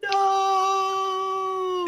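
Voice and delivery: long high sung "no"; hammed-up despairing cry; mock wail. Mock protest at GM leaving.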